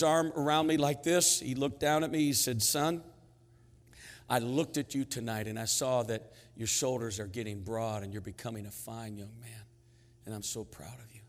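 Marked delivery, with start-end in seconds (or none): 2.6-11.2 low, hushed words from a proud dad; quiet, heartfelt affirmation; tender fatherly praise. Retold or imitated. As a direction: speak softly, as father to son.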